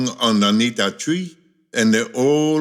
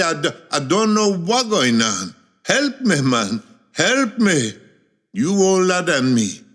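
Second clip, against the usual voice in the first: high, hammed-up begging; helpless cries swooping up and falling away; desperate pleading; 4 semitones higher than usual. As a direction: plead with a friend for rescue. Confused man pleads with his friend.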